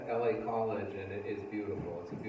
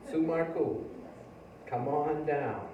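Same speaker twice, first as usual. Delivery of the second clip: higher call with a drawn-out ending; calling someone up; 5 semitones higher, much slower than usual. Real, not acted. Host calls Sue up to read poems, calling out. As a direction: summon the next reader, stretching the last words.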